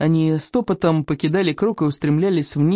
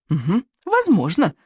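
higher, small doubtful squeak; squeaky; 4 semitones higher than usual. A narrator's reading. Nervous friend half-agrees with the plan, squeaking. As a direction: squeak out the reply. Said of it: "пискнул Рыбьенок".

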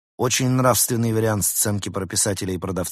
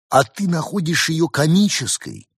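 high, loud, incredulous challenge; wincing retort; 9 semitones higher, 5 dB louder than usual. A narrator's reading. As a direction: throw the question back with a grimace in the voice. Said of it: "скривился Марсель". (возмущённо, скривившись)

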